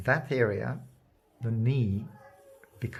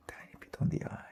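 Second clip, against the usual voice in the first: whispered aside; 7 dB quieter, faster than usual. Real, not acted. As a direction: whisper to himself mid-stroke. Painter murmuring while working.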